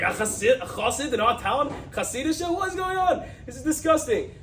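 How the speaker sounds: outraged townsfolk. Retold or imitated. Friends hearing the news, outraged high-pitched exclamations. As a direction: pitch climbing in disgust. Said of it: "They all go crazy".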